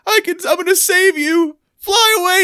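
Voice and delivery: very high imitated cry of rescue; mock-dramatic Kelly. Kelly frees her pet turkey at the window, high melodramatic cry. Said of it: "And she's like".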